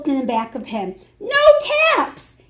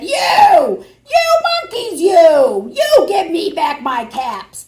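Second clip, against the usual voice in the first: much higher, louder angry yell; angry shout; 8 semitones higher, 8 dB louder, slower than usual. Acted. Angry peddler shouting at the monkeys for his caps. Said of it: "This made the peddler angry".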